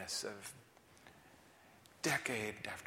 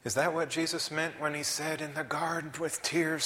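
ordinary talk, nothing marked

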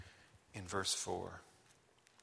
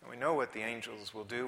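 usual speaking voice

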